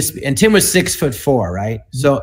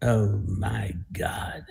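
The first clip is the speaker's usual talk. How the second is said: a slow, unvoiced, stunned whisper; whispered horror; 6 semitones lower, 13 dB quieter, much slower than usual. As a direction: breathe "Oh my God" out in slow-motion shock. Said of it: "And I go".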